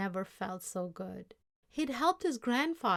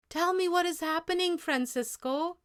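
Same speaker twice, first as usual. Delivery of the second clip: anxious; 9 semitones higher, 7 dB louder than usual. Acted. Grandfather anxiously demands.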